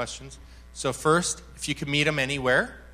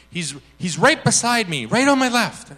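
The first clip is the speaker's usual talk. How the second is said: raised, loud eager answer; manic excitement; 7 semitones higher, 8 dB louder than usual. Retold or imitated. Woman saying where God stands, manic excitement. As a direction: imitate her blurting out the discovery. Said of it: "she was manic".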